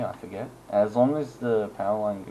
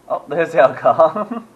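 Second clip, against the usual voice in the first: loud, slow, delighted call; excited; 7 semitones higher, 15 dB louder, much slower than usual. Real, not acted. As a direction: cheer as the cars roll in. Cars finally arrive in town.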